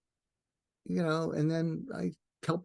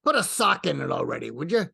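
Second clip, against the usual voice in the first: put-on higher, louder grumpy Brooklyn accent; a fed-up old New Yorker from Brooklyn, pushing him away; annoyed Brooklyn-accented mentor grumbling at being woken; Larry King's Brooklyn accent; 7 semitones higher, 4 dB louder than usual. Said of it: "here's the loving thing he says".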